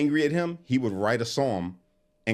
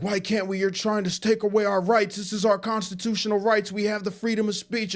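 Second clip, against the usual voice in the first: high, whining mock protest voice; whiny fit; 11 semitones higher than usual. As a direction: mimic a man throwing a tantrum over his rights. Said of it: "Jake would throw a fit".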